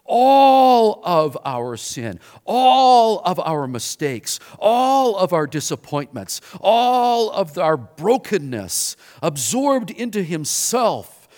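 loud, slow, each all hammered; sermon climax on sin absorbed, loud and slow; preaching emphasis. Acted.

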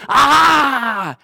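high, loud drawn-out "aah"; triumphant mock yell. Showing Google proof to a doubter, mock gloating shout. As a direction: gloat loudly over the proof.

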